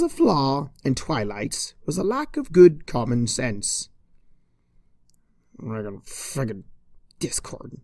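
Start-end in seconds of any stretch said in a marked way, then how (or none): 5.6-7.8 muttering, nearly voiceless; muttered curse. Acted. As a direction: curse him quietly to yourself. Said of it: "Twilight muttered".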